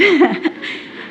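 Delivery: short amused laugh. Real, not acted. Speaker admitting she wanted every banana, amused laugh. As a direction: laugh at your own confession. Laughs at her own confession.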